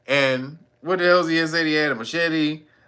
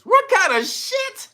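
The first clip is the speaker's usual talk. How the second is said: very high, slow incredulous cry; disbelief; slower than usual. Real, not acted. Vlogger reacting to the ruling, high disbelief. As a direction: stretch it out in disbelief.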